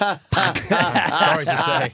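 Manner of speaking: slow, high, loud laughing reply. Real, not acted. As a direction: laugh through the apology. Laughing verdict.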